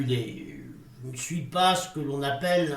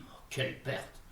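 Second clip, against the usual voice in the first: breathless whispered lament; Oh, quelle perte, barely breathed; hushed despair over the loss; 4 semitones lower, 14 dB quieter than usual. Acted. Grieving lost speech.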